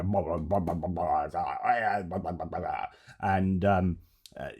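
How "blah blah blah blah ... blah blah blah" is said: low blustering British leader; Boris Johnson. Acted. Host mocking how British leaders stand and talk, blustering impression. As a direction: bumble through meaningless blahs as a mock British politician. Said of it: "bit of Boris Johnson there".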